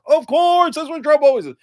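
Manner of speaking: loud exasperated refrain. Real, not acted. Same refrain repeated.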